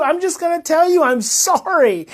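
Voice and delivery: ordinary talk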